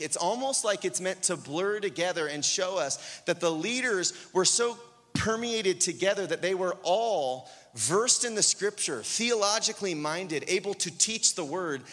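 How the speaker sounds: ordinary talk, nothing marked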